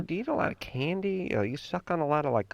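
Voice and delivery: high, soft prying dentist voice; lightly mocking a nosy dentist; mimicking the dentist. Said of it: "they asked me the question".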